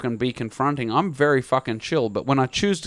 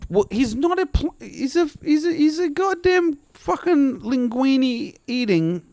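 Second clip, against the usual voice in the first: high, halting, slow splutter; exasperated insults; 7 semitones higher, slower than usual. Real, not acted. Comic venting about plumber to co-host, high spluttering exasperation. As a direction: strain for each insult, word by word.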